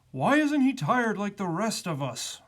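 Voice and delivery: weary grumbling question; moaning bear. A narrator's reading. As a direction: moan it like a tired bear. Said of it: "moaned the bear".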